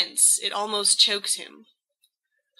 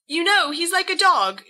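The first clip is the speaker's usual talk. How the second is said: gabbling; 8 semitones higher, 4 dB louder than usual. Acted. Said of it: "gabbles James".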